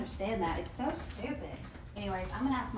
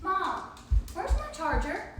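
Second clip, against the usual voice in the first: higher, loud call; yelling across the room; 7 semitones higher, 9 dB louder than usual. Real, not acted. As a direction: shout the question to mom. Calling mom from afar.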